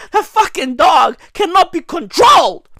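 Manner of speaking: loud and high, ranting about the beast within; rising rant. Acted. Comedian to the audience, a loud rising rant. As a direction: shout it like the dog is breaking loose.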